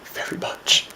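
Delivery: choked, halting words breaking high; struggling to speak while crying; tearful grief. Acted. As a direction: mourn a lost friend through tears. Grieving a friend's suicide.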